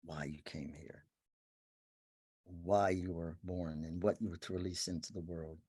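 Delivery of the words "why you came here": soft unvoiced whisper. Real, not acted. Teacher to seekers about life purpose, whispered. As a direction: drop to a secret whisper. Intimate spiritual talk.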